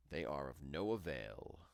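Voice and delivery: dismissive witch. A narrator's reading. Witch scorns Gretel's tears.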